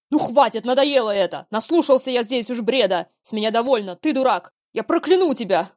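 loud, high furious outburst; angry shouting. Acted. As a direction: bellow at the son in rage. Father loses patience, curses son.

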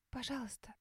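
тихая мольба сквозь всхлипы; почти неслышно, сквозь слёзы; всхлипывая. Acted. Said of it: "Схлипываю я". (тихо всхлипывая)

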